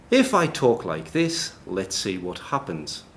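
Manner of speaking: slow, careful posh English accent. Shown as a demonstration. Showing the audience voice recognition with a very posh voice. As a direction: put on a posh voice, each word measured. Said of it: "very posh voice".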